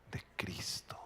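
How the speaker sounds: whispered answer. Acted. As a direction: breathe it out as a secret.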